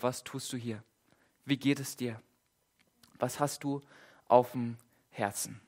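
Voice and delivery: soft and quiet, inviting; God softly asking Elia what weighs on his heart; gentle voice of God. Acted. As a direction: ask tenderly, opening the door. God's gentle question retold.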